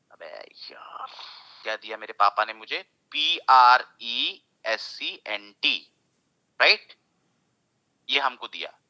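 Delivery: ordinary talk, nothing marked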